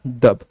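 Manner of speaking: a low, soft "डब" like a heartbeat; low soft dub. Shown as a demonstration. Teacher showing students the second heart sound, low and soft. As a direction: drop to a hushed "डब", the heart's second thud. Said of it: "सुनाई दे दिया है क्या?".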